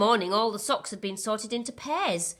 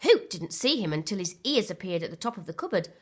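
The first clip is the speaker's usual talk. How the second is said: ordinary delivery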